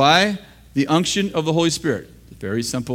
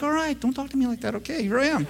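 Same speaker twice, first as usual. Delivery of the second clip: meek offended surrender; 9 semitones higher, 7 dB quieter, faster than usual. Retold or imitated. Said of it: "I'm like".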